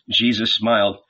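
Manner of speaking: plain reading voice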